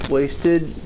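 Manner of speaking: ordinary delivery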